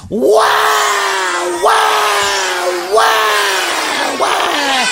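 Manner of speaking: put-on baby howls at the principal, very high; baby crying wah. A narrator's reading. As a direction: howl like a furious infant. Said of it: "It went".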